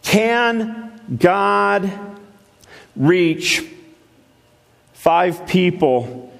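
Can God, reach, five people, spaced out slowly; slow measured question. Real, not acted. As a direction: drop each phrase in with pauses. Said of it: "Let me ask you something".

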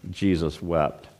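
softly solemn Jesus wept; hushed reverent reading. Acted. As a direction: let it fall quietly, with reverence. Preacher slows on famous verse.

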